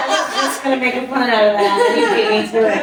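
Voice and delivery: laughing. Real, not acted. Friend beaten to a pun, laughing loudly. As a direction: talk through your laughter.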